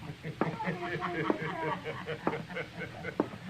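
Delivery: drawn-out mocking cowboy laugh; rowdy laugh. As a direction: laugh at the lady, taunting.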